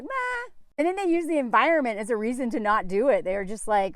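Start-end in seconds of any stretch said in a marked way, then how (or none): 0.0-0.5 a high, soft dismissive meh; mock shrug. French organizers shrugging off ice baths, dismissive. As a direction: brush it off lazily. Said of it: "they're like".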